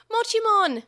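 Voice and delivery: very loud, high scream of a name; anguished scream. A narrator's reading. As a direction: cry out in despair. Children screaming partners' names.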